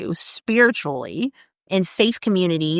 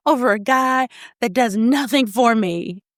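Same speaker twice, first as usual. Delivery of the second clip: quoting crying woman; 6 semitones higher than usual. Retold or imitated. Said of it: "she was like".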